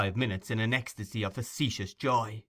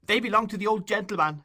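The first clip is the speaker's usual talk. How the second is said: put-on pleading boy; 11 semitones higher, 4 dB louder than usual. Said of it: "uttered with all the energy of passionate grief".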